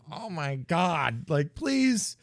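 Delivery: high, loud embarrassed plea to leave; mortified pleading. Retold or imitated. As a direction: squeal it like you want to disappear. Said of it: "I'm like".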